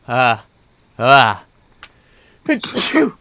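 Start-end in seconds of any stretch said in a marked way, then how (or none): 2.5-3.1 a full, sudden real sneeze. Real, not acted. Pausing her dating story to sneeze, a real sneeze. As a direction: let a real sneeze burst out. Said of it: "I have to sneeze".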